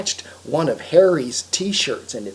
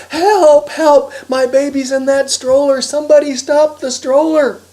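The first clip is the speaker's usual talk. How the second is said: high, loud desperate yelling; 11 semitones higher, 10 dB louder than usual. Acted. Harry begs anyone around to stop the stroller, yelling. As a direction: yell to anyone who might hear. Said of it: "He just yelled anyway".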